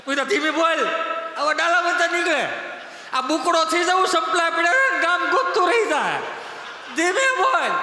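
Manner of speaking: high, loud urgent hush-up; mock frantic warning. Warning to speak softly, yet shouted.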